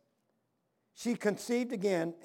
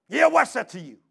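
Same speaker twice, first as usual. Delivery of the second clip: gruff retort; 5 semitones higher, 10 dB louder than usual. Retold or imitated. Hostile farmer answers preacher.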